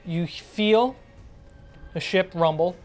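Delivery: ordinary talk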